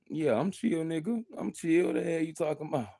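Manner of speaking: defensive insistence. Real, not acted. Insisting to commenter Razavon.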